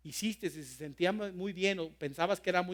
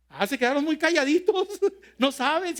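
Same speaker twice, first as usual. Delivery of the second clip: playful chuckling tease, higher and louder; teasing with a laugh; 8 semitones higher, 6 dB louder than usual. Real, not acted. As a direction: laugh at their silence and rib them. Teasing the silent congregation.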